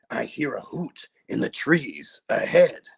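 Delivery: menacing Gruffalo. Acted. The Gruffalo speaks on the walk.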